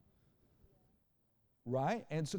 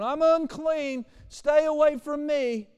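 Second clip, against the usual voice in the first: very high, slow loud holler; leper's warning call; 12 semitones higher, 10 dB louder, slower than usual. Retold or imitated. Leper at the market warning shoppers off, drawn-out high shout. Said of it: "gotta walk around going".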